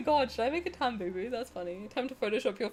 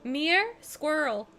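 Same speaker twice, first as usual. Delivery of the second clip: higher-pitched American "Mirror? Squirrel."; playful American accent imitation; 7 semitones higher, slower than usual. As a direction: imitate an American saying "mirror" as one syllable, "meer". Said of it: "favorite things to hear Americans say".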